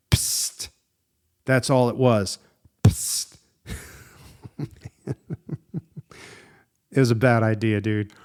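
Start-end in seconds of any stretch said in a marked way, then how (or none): 0.1-0.7 a soft unvoiced psst of spray; spray hiss. Showing listeners the bear spray's burst: soft hissing psst. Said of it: "it just went like this". 2.8-3.4 a short unvoiced psst at full level; spray hiss. Repeating the bear spray's burst for listeners: crisp hissing psst. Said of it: "it just went like this".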